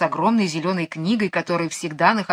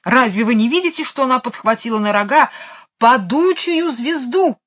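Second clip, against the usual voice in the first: higher, urgent royal outburst; exasperated exclamation; 5 semitones higher, 4 dB louder than usual. A narrator's reading. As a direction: insist on the obvious, agitated. King berates courtiers, excited.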